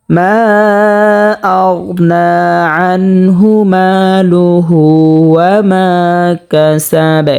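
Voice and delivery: slow, even chant with stretched long vowels; measured tajweed model; Quran recitation. Shown as a demonstration. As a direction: recite phrase by phrase, holding each long vowel. Teacher recites the verse demonstrated.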